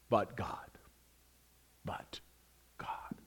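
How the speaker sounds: hushed, breathy "but God", drawn out with pauses; a hushed secret "but God"; whispered but God. Acted. Repeating but God, whisper then loud.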